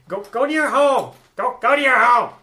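high, loud angry yelling; angry man yelling. Retold or imitated. GM voicing the angry man behind the dragon, yelling. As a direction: shout her toward home from behind. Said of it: "angry man behind her yelling".

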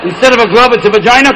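ordinary talk, nothing marked

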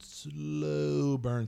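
exaggeratedly elongated "slow"; drawn-out SLOW. Shown as a demonstration. Repeats, stretching slow for emphasis.

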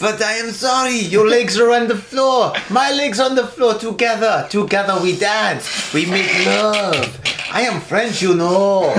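hammed-up, slow French accent; badly faked French accent, crooning the words. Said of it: "That was really bad French accent".

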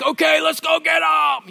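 high, hammed-up rallying shout; rally the troops with a mock battle cry; war cry. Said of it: "shouting the war cry".

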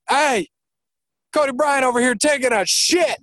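shouting out. Real, not acted. Calling out across the course.

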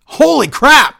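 loud, high burst of disbelief; stunned excitement. Real, not acted. Podcast host reacting to the promo's final twist, shouting in amazed excitement. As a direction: blurt it out like you can't believe the ending.

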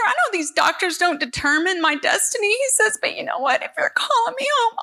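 high, teary voice breaking into a sob-laugh; crying through the words of a sweet prayer; tearful, high voice with laughing sobs. Real, not acted. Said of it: "if you're wondering why am I crying".

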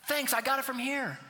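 high, loud careless brush-off; mock flippant. Believer brushing off Jesus' grace, mocking careless voice. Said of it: "So you say".

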